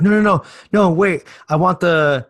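put-on high, loud desperate little boy; pleading kid voice. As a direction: shout it like a panicked kid. Ralphie at Santa.

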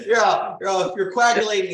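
giggling through the words; can't stop laughing mid-sentence. Real, not acted. Giggling through joke sign-off.

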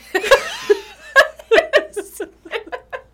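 squealing high laugh; loud high cackle; high shriek of laughter. Real, not acted. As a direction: burst into a shrieking giggle. Big laugh at joke.

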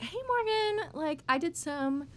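high, sing-out fake hello; fake casual greeting. Acting out fake casual FaceTime greeting.

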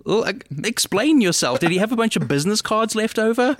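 high-pitched, exasperated questioning; mock incredulous. Co-host challenging the beekeeper's absurd backstory, mock incredulous. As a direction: demand answers, pitch climbing as you go. Mock demand to explain film plot.

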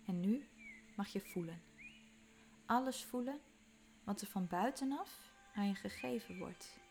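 ordinary talk, nothing marked

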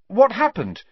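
high, loud sudden alarm; startled question. A narrator's reading. Harry waking in the hospital wing, startled question. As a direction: jolt upright asking in alarm. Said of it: "sitting up so suddenly they all gasped".

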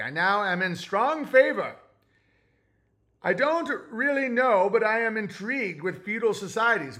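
put-on old-timey voice. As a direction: read it like a vintage radio announcer. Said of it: "Read an old-timey voice".

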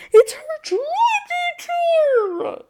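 high, loud exclaim; excited shout. Real, not acted. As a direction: shout it, thrilled. Exclaiming about the tour.

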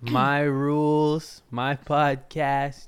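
each word drawn out slowly; slow, defiant. Acted. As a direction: lay down the law, deliberately. Defiant joke about posting late.

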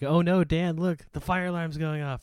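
loud, high mock panic; shouting over fire alarm. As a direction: call out over a blaring alarm. Staged fire alarm bit.

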